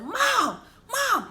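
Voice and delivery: panicked call. Retold or imitated. Calling mom in panic.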